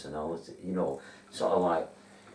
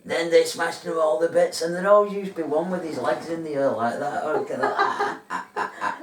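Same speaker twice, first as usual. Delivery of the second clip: high loud cackling Martian; loud, laughing robot Martian from the advert; Smash Martian voice; 10 semitones higher, 11 dB louder than usual. Retold or imitated. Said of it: "are you gonna do your Martian impression".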